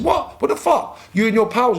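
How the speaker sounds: high-pitched, incredulous challenge; indignant confrontation. Retold or imitated. Acted confrontation with the kid.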